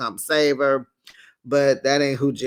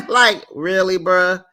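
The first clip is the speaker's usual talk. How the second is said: disbelief; 4 semitones higher, 6 dB louder, slower than usual. Real, not acted. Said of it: "reacting in disbelief".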